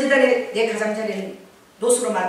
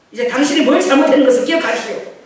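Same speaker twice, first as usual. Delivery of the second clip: stern; 4 semitones higher, 8 dB louder than usual. Retold or imitated. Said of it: "딱 표정 갖죠".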